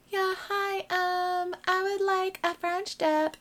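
high, small polite ordering voice; tentative, high-pitched counter order; timid customer. Retold or imitated. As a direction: order sweetly in a high, stretched-out voice.

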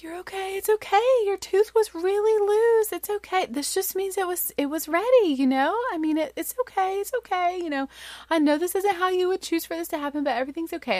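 high-pitched soothing mommy voice; consoling. Retold or imitated. Mom consoling sobbing daughter, high retold reassurance. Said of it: "trying so hard to console her".

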